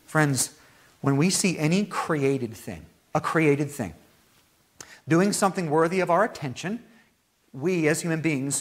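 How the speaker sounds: ordinary delivery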